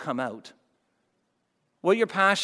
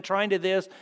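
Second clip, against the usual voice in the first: ordinary delivery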